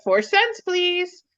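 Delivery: high, lilting clerk's voice; retold polite request. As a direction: ask for payment way up high, rising. Clerk asking for payment, retold.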